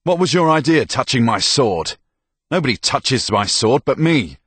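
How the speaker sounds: loud, high, possessive anger; angry, forceful telling-off; furious reprimand. Acted. Soldier berates girl for touching sword.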